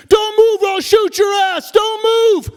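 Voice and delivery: armed officer screaming orders; police shout. Acted. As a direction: yell it like a cop in a raid.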